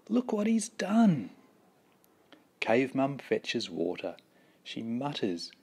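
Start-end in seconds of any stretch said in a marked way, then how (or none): 0.1-1.3 high, sharp scold on "done"; mock-furious parents, much higher. Cave parents scolding Cave Baby, high angry cry. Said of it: "Mum and Dad are furious and say".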